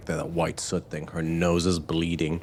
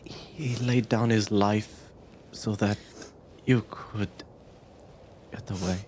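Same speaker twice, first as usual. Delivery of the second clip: grieving; 3 semitones higher than usual. Acted. Game master as survivor breaking news of a death, grieving. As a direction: let it out haltingly, close to tears.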